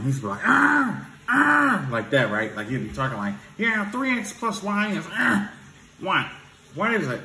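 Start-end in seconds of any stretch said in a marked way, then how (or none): 0.4-1.9 high loud throat-clearing ah; teacher's throat clear, imitated high and loud. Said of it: "he used to clear his throat". 3.6-7.2 high voice broken by throat-clearing ahs; student imitating the teacher's lesson in a high voice; teacher's talking voice. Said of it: "he would talk like that".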